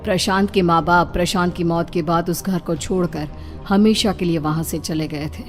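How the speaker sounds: ordinary delivery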